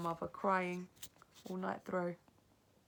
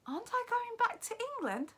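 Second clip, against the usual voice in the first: put-on much higher child's voice; young boy; 7 dB louder than usual. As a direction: ask like a worried small boy. Boy's question.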